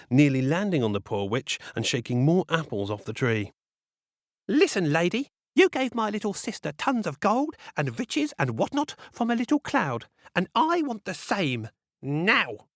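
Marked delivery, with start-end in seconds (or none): 4.5-12.7 high, rude spoiled demand; bratty demanding girl. Acted. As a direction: insist like a brat.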